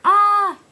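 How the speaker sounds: loud high-pitched scream. Acted. Bert screaming as he is lifted. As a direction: shriek in fright.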